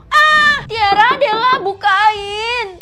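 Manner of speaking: high, full-volume wail for help; desperate yelling. Acted. Pupil locked in storeroom calling friends, panicked shouting. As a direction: scream to be let out.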